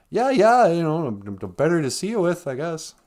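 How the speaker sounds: high, loud brush-off; hammed-up evasive wolf; flustered wolf as grandma. Voices wolf brushing her off.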